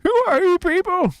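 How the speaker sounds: put-on high, loud cracking old man greeting; other old man voice. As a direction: greet your viewers like a wheezy grandpa. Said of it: "old man voice".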